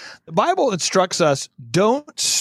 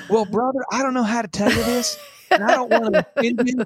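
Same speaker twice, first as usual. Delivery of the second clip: a lightly voiced, overly polite churchy brother, a bit higher; mock Christianese; 3 semitones higher than usual. Guest mocking a pastor who spoke Christianese to him, lightly voiced. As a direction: imitate a pastor softening bad news in church-speak. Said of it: "'cause he's speaking Christianese".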